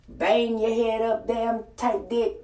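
normal talking voice

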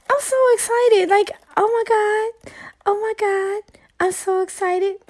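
high-pitched giddy gushing; so excited. Real, not acted. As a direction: squeal it out, thrilled beyond words. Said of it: "I'm just, I'm excited".

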